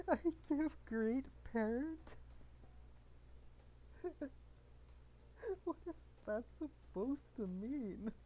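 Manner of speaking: high and slow through giggles; real laughing bewilderment; incredulous laughter. Real, not acted. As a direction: drag the words out while cracking up. Rereads garbled line while cracking up.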